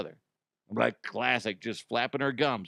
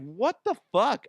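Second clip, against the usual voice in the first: shocked outburst; 7 semitones higher than usual. Retold or imitated. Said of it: "And I'm like".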